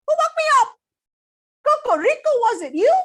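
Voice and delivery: high loud angry pig; grumpy pig voice. Acted. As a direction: bellow it, just woken and cross. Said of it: "woke up with a start".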